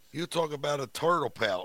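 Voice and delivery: normal talking voice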